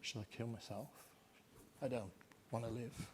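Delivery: very soft, barely voiced despair; almost give up on the words; hopeless despair. Shown as a demonstration. Lecturer evoking Hamlet's despair, very soft. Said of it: "Back to Hamlet".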